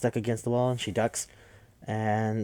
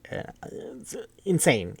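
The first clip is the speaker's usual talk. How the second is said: slow, high, stretched-out insane; drawn-out amazement; 6 semitones higher, slower than usual. Real, not acted. Marvelling to listeners at the movie's ending, stretched slowly. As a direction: let the word insane hang in disbelief. Reacting to the scene's gore.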